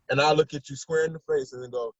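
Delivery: ordinary delivery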